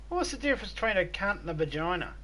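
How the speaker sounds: a hint of a young boy asking; kid voice. Son asking his dad a cheeky question, lightly voiced kid.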